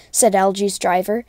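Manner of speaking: usual speaking voice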